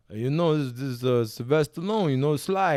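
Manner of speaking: movie-boxer voice on the phone; Sylvester Stallone. Acted. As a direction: imitate the action star naming himself. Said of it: "Make sure you do his voice".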